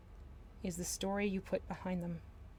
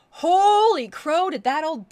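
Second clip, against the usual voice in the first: very high, loud, slow holy crow; stretched, astonished cry; amazed exclamation; 9 semitones higher, 15 dB louder, slower than usual. Real, not acted. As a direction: burst out in disbelief. Amazed at her changed tune.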